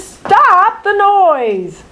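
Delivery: very slow, drawn-out high wail; stretched bat screech. Acted. Bad Egg Bat worn out by the noise, slow high wail. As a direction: stretch the plea out. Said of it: "he screeches".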